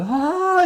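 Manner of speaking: high loud scream; imitating a scream; Wybie scream. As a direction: scream in fright. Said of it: "does that scream".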